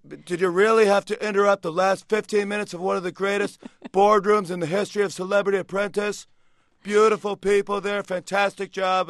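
high, loud, boastful Trump voice, slowing at the end; Donald Trump impression. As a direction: scold the president like a showman. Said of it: "I did a Donald Trump impression".

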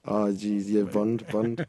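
"burned" said as "bunnd" in a Scottish accent. Real, not acted. Scottish singer confirming his word for the interviewer, Scottish accent. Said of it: "having trouble with your Sc-, Scottish accent".

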